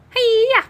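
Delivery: sharp high karate shout; karate yell. Acted. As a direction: yell it as you strike. Karate cat demonstrates his art.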